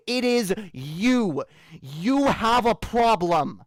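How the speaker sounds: furious, deliberate lecture; slow furious rant. Real, not acted. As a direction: pound every line home in anger. Ranting at the troll.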